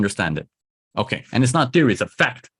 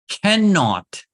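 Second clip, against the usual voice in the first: cannot pushed way up, drawn out, falling; underlined word; 7 semitones higher than usual. Shown as a demonstration. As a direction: lean hard on cannot, higher, falling. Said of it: "let me under, underline this word for you".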